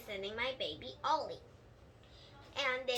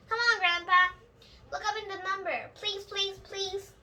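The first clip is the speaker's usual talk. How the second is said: begging; 6 semitones higher, 6 dB louder than usual. A narrator's reading. Junie B. begs grandpa.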